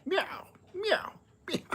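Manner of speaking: high squeaky imitated meowing; mock kitten meows. Man imitating his crybaby kitten, squeaky meows. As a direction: mimic a whiny crying kitten.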